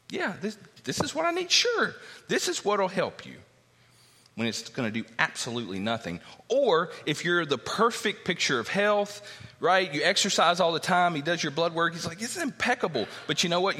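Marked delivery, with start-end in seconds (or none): 0.1-3.4 high put-on voice, falsely cheerful; mock gullible patient. Sarcastic patient accepting sugar pill. 12.2-13.8 a hint of a cheery doctor, higher; chirpy doctor giving odd advice; lightly voiced doctor.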